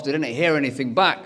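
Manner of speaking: usual speaking voice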